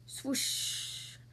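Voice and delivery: soft, airy swoosh; whooshing water sound. A narrator's reading. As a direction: let the swoosh hiss away softly. Imitates stick rushing down creek.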